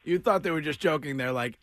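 ordinary delivery

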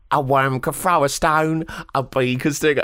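singing-style terrible Cockney; Cockney accent. Retold or imitated. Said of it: "There's a terrible Cockney accent".

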